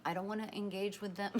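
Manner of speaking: ordinary delivery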